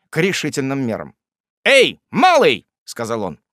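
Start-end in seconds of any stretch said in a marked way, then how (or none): 1.6-2.7 loud, high, drawn-out hail; calling out. A narrator's reading. The actor hails the servant boy, calling out. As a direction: summon the servant imperiously.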